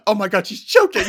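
high, loud overblown alarm; hammed-up horrified outcry; mock panic. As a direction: imitate an instant over-reaction. Other speaker imitates the panic.

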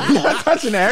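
laughing through the words. Real, not acted. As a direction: giggle while refusing. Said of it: "repeating refusal, laughing".

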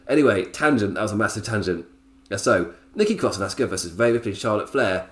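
usual speaking voice

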